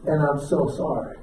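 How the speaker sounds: low, soft heartfelt apology; tender sincerity. Retold or imitated. Husband apologising to his wife that night, low tender sincerity.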